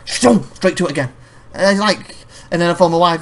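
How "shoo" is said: shooing. Retold or imitated. Shooing the dog away.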